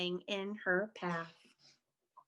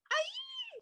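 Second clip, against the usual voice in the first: very high, thin squeal, kept soft; mock shriek; 8 dB quieter than usual. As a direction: squeal high in held-back panic. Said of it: "shrieked Flap".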